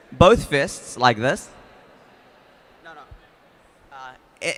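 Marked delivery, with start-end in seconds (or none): 0.1-1.5 much louder, calling over the crowd; loud demo for the room; loud instruction. Real, not acted. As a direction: project it to the room. Demonstrating to the room.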